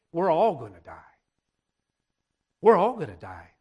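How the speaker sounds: low, hushed dread of dying people. Retold or imitated. Frightened people facing death, low and hushed.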